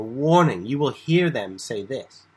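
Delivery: "Warning," loud, then slowed words; Jesus warns hearers, "Warning," stressed loud. Shown as a demonstration. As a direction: call out "Warning," then press the rest slowly. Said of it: "it's more like warning".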